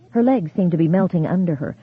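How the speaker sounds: ordinary delivery, nothing marked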